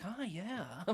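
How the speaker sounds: customer service voice. Retold or imitated. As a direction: put on a polite customer-service lilt. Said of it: "puts on his customer service voice".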